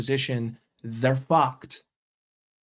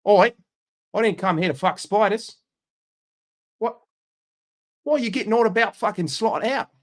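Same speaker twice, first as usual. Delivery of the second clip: higher-pitched, indignant Aussie rant; mock-offended Australian football fan; Australian accent; 4 semitones higher than usual. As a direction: put on an outraged Australian accent. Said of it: "Australian's also fun".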